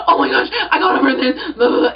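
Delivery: loud, high squeal with a laugh; imitated excited scream. Telling friend she got the role, mock excited screaming. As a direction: re-enact screaming down the phone. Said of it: "screaming, like".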